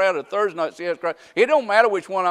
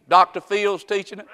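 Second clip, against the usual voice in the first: ordinary talk, nothing marked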